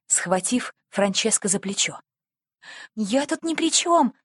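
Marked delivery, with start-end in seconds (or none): 2.9-4.1 high, anxious plea of innocence; agitated protest. A narrator's reading. Boy protesting innocence to the policeman, agitated. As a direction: protest nervously, pitched well up. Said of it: "прерывающимся от волнения голосом".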